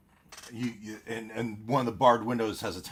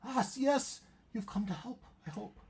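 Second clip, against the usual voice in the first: higher, fading hopeful woman; high, trailing off softer; 12 semitones higher, 5 dB quieter than usual. Acted. GM voices woman.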